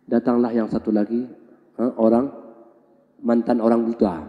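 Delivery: ordinary talk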